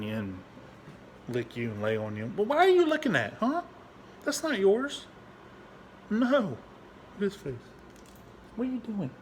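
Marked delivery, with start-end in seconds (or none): 2.4-9.1 very high pet-talk voice, loud at first; playful dog scolding. Real, not acted. Owner catching the dog licking, high playful scolding. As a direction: chide the dog in a high-pitched coo.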